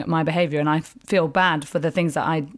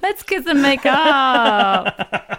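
high, loud squeal then slow laughing coo; hammed-up excitement, laughing; mock delighted squeal; 9 semitones higher, 5 dB louder, much slower than usual. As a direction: play the overjoyed reunion for laughs. Joking excitement at ex backstage.

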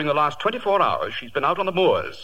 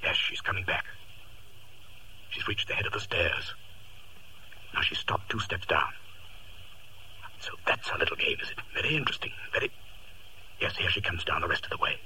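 whispering; 6 semitones lower, 8 dB quieter than usual. Acted. Holmes whispers while watching.

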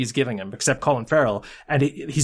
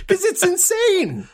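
incredulous; slower than usual. Retold or imitated. Still high after imitation.

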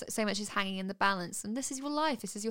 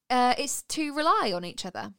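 normal talking voice

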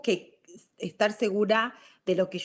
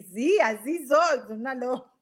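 entre risas; 4 semitones higher, 4 dB louder than usual. Real, not acted. Laughing confession about herself.

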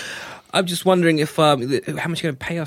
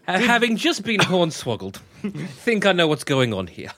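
normal talking voice

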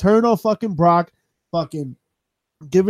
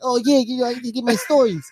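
mock Brooklyn accent, pitched higher; 6 semitones higher, faster than usual. Mimicking the actor in the trailer, mock Brooklyn accent. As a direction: put on a light Brooklyn voice. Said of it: "Tom Hardy's Brooklyn accent just grinds my gears".

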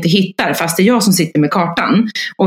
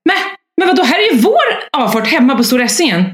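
shocked realization; 7 semitones higher, faster than usual. Retold or imitated. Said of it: "tittar jag upp efter en stund och säger".

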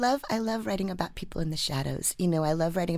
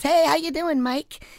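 bright, loud, high hello across the store; cheery greeting; 13 semitones higher, 7 dB louder, slower than usual. Retold or imitated. Customer calling out a cheery hello to the clerk, bright and loud. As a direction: call out a big friendly hi. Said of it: "someone goes".

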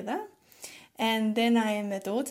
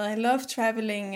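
ordinary talk, nothing marked

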